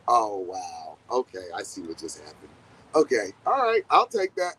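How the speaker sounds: low, sobered mock acceptance; resigned realization. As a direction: take in the consequence, pitched low. Acting someone accepting karma's payback.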